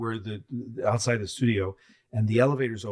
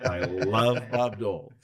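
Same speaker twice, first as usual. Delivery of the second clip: slow, laughing mock adoration; laughing gushing; slower than usual. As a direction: gush it through a laugh. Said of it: "I was like".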